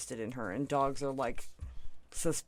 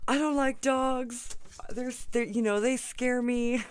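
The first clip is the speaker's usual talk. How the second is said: higher, louder, slow mock-frightened whine; imitating scared neighbor; 8 semitones higher, 4 dB louder, slower than usual. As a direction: play the neighbor scared of dogs, hammed-up. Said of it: "he was like".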